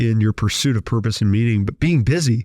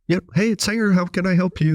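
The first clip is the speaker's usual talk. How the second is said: bright, much higher customer-service voice; chipper phone greeting; 8 semitones higher than usual. Retold or imitated. Answering the client's call at five a.m., chipper and high. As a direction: answer like an eager helpful assistant, pitched up.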